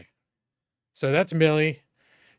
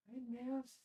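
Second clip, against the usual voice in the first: much higher sing-song call to the puppy; high pet voice; 10 semitones higher, 18 dB quieter than usual. Real, not acted. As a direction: lift the voice high to greet the dog. Calling the puppy sweetly.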